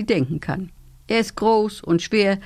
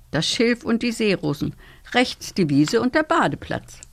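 ordinary delivery

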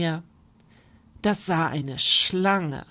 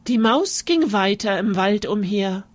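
usual speaking voice

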